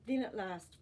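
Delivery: plain reading voice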